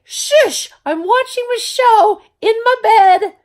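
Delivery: high, loud exasperated shush; retold shushing yell. Mom yelling at gaming sons to hush. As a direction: hush them loudly from the bed. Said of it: "And I'm like".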